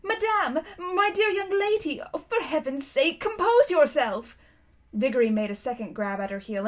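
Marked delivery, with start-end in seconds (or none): 0.0-4.4 high, panicky pleading; trembling scream. A narrator's reading. Uncle Andrew begging the witch to compose herself, trembling scream. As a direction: plead in a frightened, high-pitched cry. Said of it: "in a sort of trembling scream".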